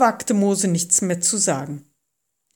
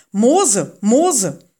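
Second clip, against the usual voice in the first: loud, urgent call of a name; 3 semitones higher, 7 dB louder than usual. A narrator's reading. Voice from the fire summoning Moses, calling out. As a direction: call him by name, loudly, twice. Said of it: "rief die Stimme".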